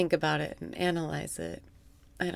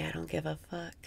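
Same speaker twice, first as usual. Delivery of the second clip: softer, letting go; softly; 3 semitones lower, 12 dB quieter than usual. Shown as a demonstration. Guiding the breath, saying the phrase softly. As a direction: say it gently on the in-breath. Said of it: "Breathe in and say".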